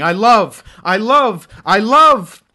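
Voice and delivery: building shout. Acted. Poem climax repeated cry.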